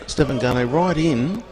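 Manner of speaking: high, loud commentary burst; excited goal call. Real, not acted.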